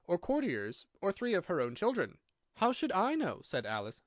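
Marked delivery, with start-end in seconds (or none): 2.5-3.4 put-on higher-pitched plucky girl; bold retort. A narrator's reading. Alice answering the Queen, surprised at her own courage. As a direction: answer back boldly in a high voice. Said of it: "surprised at her own courage".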